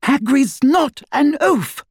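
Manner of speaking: very high, slow, indignant retort; high-pitched outraged defence; shrill. A narrator's reading. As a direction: protest shrilly, stressing each word. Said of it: "said Hermione shrilly".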